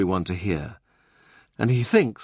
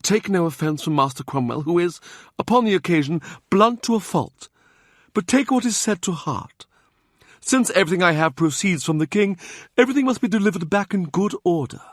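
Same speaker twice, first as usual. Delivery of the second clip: put-on higher, measured and dignified old churchman; a fallen prelate keeping his grace; the Cardinal's voice; 9 semitones higher than usual. A narrator's reading. The Cardinal gently rebukes Gascoigne about Cromwell, dignified and higher.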